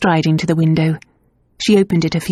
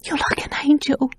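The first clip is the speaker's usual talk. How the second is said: much higher, tearful whisper; miserable whisper; 9 semitones higher, 4 dB quieter than usual. A narrator's reading. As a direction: whisper it through tears. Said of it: "she whispered miserably".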